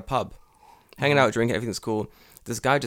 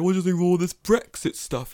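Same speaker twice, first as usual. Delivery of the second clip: higher-pitched British pub-bore impression; mocking UK accent; 7 semitones higher, 3 dB louder than usual. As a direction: imitate him in a mock British accent. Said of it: "your mocking, like, U K accent".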